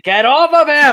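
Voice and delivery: loud, high battle yell; shouting. Acted. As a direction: yell it like a charging knight. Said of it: "like, just shouting".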